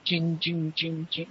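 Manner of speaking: soft jingling ching sounds; sleigh bell imitation. Boy hearing sleigh bells at night, soft imitation. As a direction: mimic sleigh bells, quietly.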